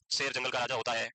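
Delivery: low monotone memorizing drone; rote recitation. Shown as a demonstration. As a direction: recite it like a student cramming by heart. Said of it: "फिर उसको रटते हैं".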